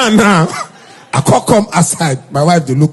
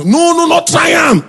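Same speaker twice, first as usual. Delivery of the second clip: urgent mock plea; 13 semitones higher than usual. Pleading caller, high urgent voice.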